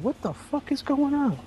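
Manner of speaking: high-pitched comic disbelief; mock bewilderment. Acts out waking up floating.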